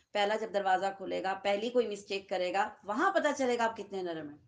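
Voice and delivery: normal talking voice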